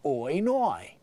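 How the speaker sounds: simple mock Australian "Oi, noi"; easy Australian accent. Shown as a demonstration. Comedian shows listeners the easy way, simple Australian accent. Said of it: "Just say".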